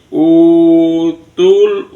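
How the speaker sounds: utul with the first u held long. Shown as a demonstration. Teacher showing the long vowel, utul with held u. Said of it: "u-nya mat tobi'i".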